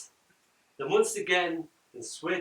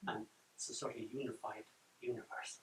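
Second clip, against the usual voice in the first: normal talking voice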